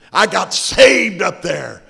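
shouted, drawn-out testimony; loud declaration. Real, not acted. Preacher shouting his testimony to the congregation, slow and loud. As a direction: proclaim it to the church.

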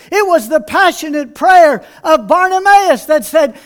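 ordinary talk, nothing marked

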